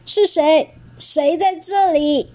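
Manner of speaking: a lightly voiced scary dinosaur demanding who's there. A narrator's reading. Blinded dinosaur challenging the stranger, slow mock-menacing. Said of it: "霸王龙却用很可怕的声音说".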